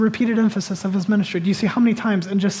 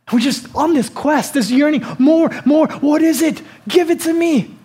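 yearning; 6 semitones higher, 4 dB louder than usual. Acted. Voicing restless yearning crowd.

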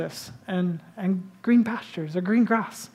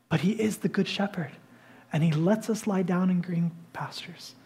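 soft refrain; 2 semitones lower, 6 dB quieter than usual. Acted. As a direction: ease down into a gentle whisper. Preacher softens on the refrain.

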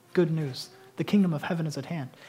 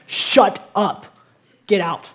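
louder, sharp order to shut up; forceful command; 7 dB louder, much slower than usual. Retold or imitated. Jesus ordering the demon out, forceful louder command. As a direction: snap it out like throwing the demon out. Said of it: "It's probably much more forceful".

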